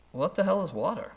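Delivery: high-pitched baffled question; puzzled young fish. Acted. Young fish turns to the other, baffled. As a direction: act out a clueless youngster, pitch jumping up. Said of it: "one of them looks over at the other".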